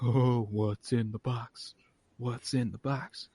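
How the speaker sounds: lightly voiced intrigued audience; mock curious crowd. Crowd wonders in chorus.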